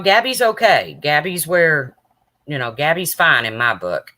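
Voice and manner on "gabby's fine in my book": ordinary talk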